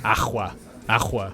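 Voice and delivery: "Agua" with a throaty ch in the middle, axwa; Scouse agua. Retold or imitated. Host copying the Scouse girl's Spanish word. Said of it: "the way that you would pronounce it with a Scouse accent".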